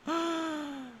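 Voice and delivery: long, exaggerated gasp; hammed-up gasp; mock shocked gasp. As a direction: gasp in feigned shock, drawn out. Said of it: "gonna make myself dizzy doing that".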